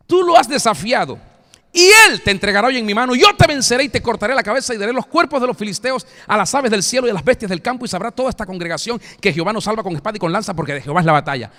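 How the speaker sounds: loud, fast defiant challenge; David defying giant. Acted. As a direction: hurl it at the giant like a young warrior. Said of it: "sino que dijo". (David desafiando al gigante, fuerte y rápido)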